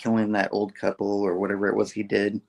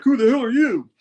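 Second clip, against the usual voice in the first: much higher, louder, in a put-on villain voice; mock tough guy; 12 semitones higher, 5 dB louder than usual. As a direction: snap it at him in a cartoon villain voice. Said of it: "and Fission's like".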